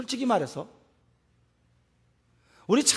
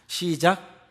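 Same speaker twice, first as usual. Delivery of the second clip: ordinary talk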